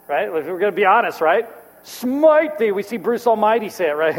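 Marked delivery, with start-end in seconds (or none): 1.8-2.7 higher, louder booming decree; hammed-up divine curse; mock smiting. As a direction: call down wrath like an angry god. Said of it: "we wanna see God smite".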